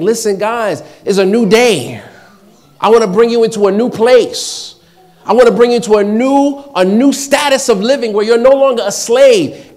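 loud, high-pitched preacher voicing God; God's urgent exhortation. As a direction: proclaim it at full force to a reluctant people.

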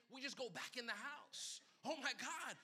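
ordinary talk

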